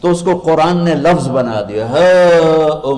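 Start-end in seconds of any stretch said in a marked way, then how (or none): 1.9-3.0 loud, drawn-out whoop; jubilant cry. Acted. Believer getting his paper in the right hand, jubilant cry. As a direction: let out a victory cry. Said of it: "वो एक नारा लगाएगा".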